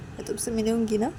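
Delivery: usual speaking voice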